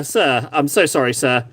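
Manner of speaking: ordinary delivery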